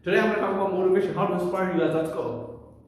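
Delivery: rushed overexcited delivery, words tumbling out; rushed too fast. Shown as a demonstration. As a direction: gabble it at double speed to show the mistake. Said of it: "you're gonna sound like this".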